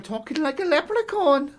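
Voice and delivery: high-pitched leprechaun Irish; Irish accent. Shown as a demonstration. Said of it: "If I do an Irish accent".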